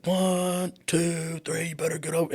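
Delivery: a father calling out his son's count, hammed up; imitating dad yelling. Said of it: "I could hear him yelling".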